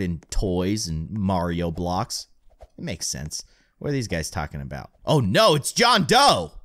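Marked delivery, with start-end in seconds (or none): 5.0-6.5 high, loud alarmed cry; sudden panicked shout. Real, not acted. Sudden alarm at villain appearing.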